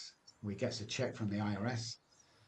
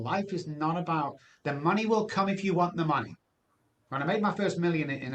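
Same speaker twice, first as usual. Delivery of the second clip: higher, louder sermon-style push; fired-up preaching; 4 semitones higher, 5 dB louder than usual. Acted. Preaching to the podcast host about staying loyal, higher and louder. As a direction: preach it like a man who has lived it.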